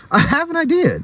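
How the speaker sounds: high squeaky Ned voice; character voice. Acted. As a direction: pitch way up for Ned. Ned's voice in read story.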